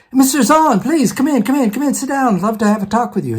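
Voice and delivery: high-pitched, eager re-enacted invitation; eager welcome. Acts out eagerly inviting the professor in.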